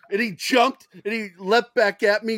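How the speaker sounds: ordinary delivery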